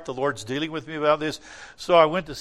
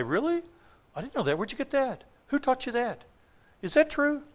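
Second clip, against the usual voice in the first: high, wide-eyed mock amazement; mock naive surprise; 9 semitones higher than usual. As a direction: play the baffled pew-sitter, pitched way up. Mocking confused church members.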